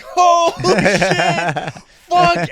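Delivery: slow, laughing holy shit; mock shocked laugh; laughing curse. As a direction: swear through held-back laughter. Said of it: "I'm like".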